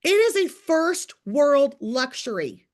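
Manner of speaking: emphatic declaration. Real, not acted. Fired-up preaching point.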